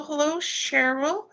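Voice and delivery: ordinary talk, nothing marked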